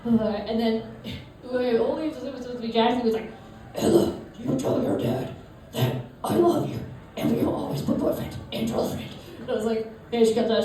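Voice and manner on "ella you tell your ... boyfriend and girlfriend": breathy mock-secret whisper; urgent whisper. Boy swearing love to Ella, urgent whisper. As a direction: whisper it like a solemn vow.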